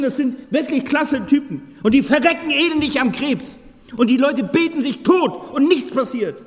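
high, loud impassioned preaching; preaching at full force. Acted. Preacher to his congregation about suffering, high loud impassioned preaching. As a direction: rail against the unfairness of it.

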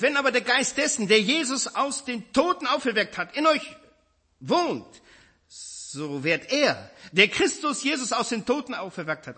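preacher reading Romans to the congregation, fervent; fervent scripture reading. Acted. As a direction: read it like a promise. Reads the verse with fervour.

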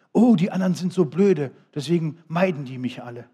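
mock a self-pitying loner; mock whiny complainer. Mocking self-pitying lonely person.

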